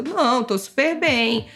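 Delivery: ordinary talk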